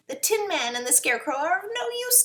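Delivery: high put-on witch voice; wicked witch. A narrator's reading. Witch orders her winged monkeys, witch voice.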